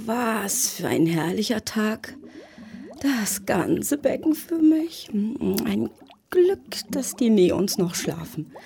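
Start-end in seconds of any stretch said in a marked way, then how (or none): none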